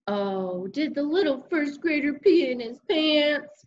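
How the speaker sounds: high, mocking big-sister voice; teasing. A narrator's reading. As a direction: needle him like a bratty sister. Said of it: "teased my sister".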